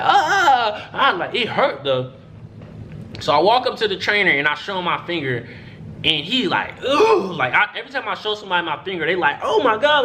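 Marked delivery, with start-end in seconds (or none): 0.0-1.2 high, hammed-up "ah, ah"; mock pain cries. Player showing how bad it wasn't, mock pain cries. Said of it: "but it don't hurt. So where it's like". 6.9-7.3 high-pitched grossed-out "ugh"; mock cringing groan. Trainer seeing the finger, imitated cringing groan. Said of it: "like, they cringe or whatever". 9.4-10.0 high, grossed-out imitation; mock cringe. People shown the finger, imitated cringing. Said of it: "like, they cringe or whatever".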